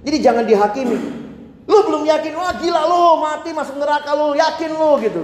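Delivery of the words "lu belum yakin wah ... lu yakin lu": high, mocking, judgmental jab; judgmental friend. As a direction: sneer at a doubter with a raised voice. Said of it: "jangan begitu".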